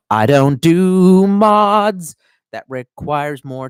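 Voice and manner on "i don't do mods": slow, loud, high "mods" drawn right out; emphatic drawl. Real, not acted. Streamer flatly refusing a viewer's mod question, slow and loud. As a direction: lay it down slowly and firmly.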